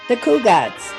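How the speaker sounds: usual speaking voice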